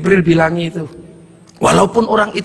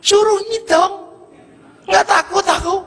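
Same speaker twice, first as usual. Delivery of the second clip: cheeky defiance; 11 semitones higher than usual. Retold or imitated. Villager defiant about his sins.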